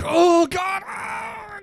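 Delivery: high, loud scream of pain; agonized scream. Acted. Police officer being tortured by the killer, screaming in agony. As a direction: cry out in sudden agony. Character's head is being steamed.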